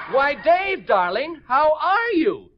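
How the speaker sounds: sister-in-law voice demo. Shown as a demonstration. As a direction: coo it like a sweet relative. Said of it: "wait a minute".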